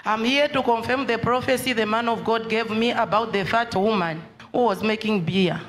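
usual speaking voice

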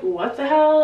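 high, loud disbelief; shocked. Retold or imitated. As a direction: caught off guard, blurting it out. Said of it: "they're all kinda like".

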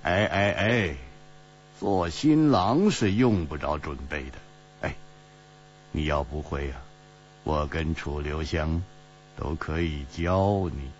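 low, unhurried, mildly mocking; amused teasing. A narrator's reading. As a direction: tease him calmly in a low voice. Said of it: "齐冰燕笑着说".

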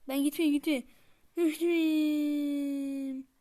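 loud panicked yell as he falls; panicked shout. Real, not acted. Gamer yelling at the screen as his character falls, loud panic. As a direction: shout it out as you lose your grip. Falling off in the game.

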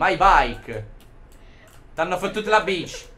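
ordinary talk, nothing marked

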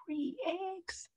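high, very soft in wonder; hushed awe. Acted. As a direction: breathe it out amazed. Awed whisper about eggs.

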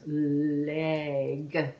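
"Leg" with a long held l, lowish and slow; stretched sounds. Shown as a demonstration. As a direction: draw out each sound of "Leg". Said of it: "made of continuous sounds".

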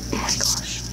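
soft whispered dismay; hushed frustrated whisper. Real, not acted. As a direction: breathe it out under your breath. Whispered exasperation after mistake.

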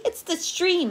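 acted bee excitement; excited cry. A narrator's reading. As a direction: pitch it up with excitement. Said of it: "cried the greedy bee excitedly".

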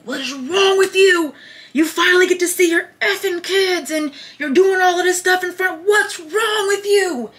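loud, high-pitched scolding shout; angry grandpa. Retold or imitated. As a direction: imitate an outraged grandfather yelling. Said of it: "when my grandpa got mad, his voice would rattle".

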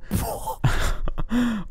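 silent wheezing laugh; unvoiced giggle. Real, not acted. Wheezing laugh at the cheesy fanfic line.

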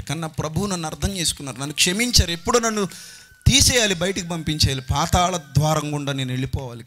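impassioned sermon climax; fervent preaching. Acted. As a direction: preach it up to a peak. Preacher building to full force.

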